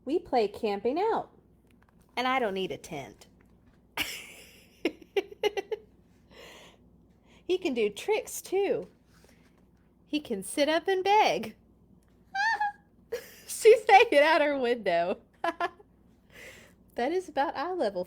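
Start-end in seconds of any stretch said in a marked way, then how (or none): 0.1-11.6 lively storybook read-aloud; reading to children. Acted. Reading Clifford aloud to viewers, bright storybook voice. Reads picture book aloud. 12.3-15.5 words squeezed out through real laughter; laughing. Real, not acted. Reacting to the picture of Clifford, laughing loudly.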